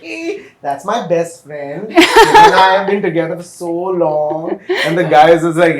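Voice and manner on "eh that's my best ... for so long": slow, laughing and higher; laughing reassurance. Acted. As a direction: chuckle through it, slow and pleased. Partner reassures about best friend.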